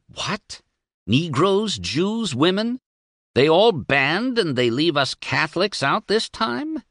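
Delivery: high, outraged priestly splutter; indignant snort. A narrator's reading. Priest reading the fifteen points, outraged snorting. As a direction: scoff in disbelief, pitched well up. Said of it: "He snorted".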